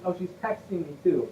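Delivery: usual speaking voice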